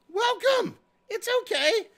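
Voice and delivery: high, sugary welcoming voice; sweet welcome. Acted. Host mock-welcoming a former hater on chat, high and sweet.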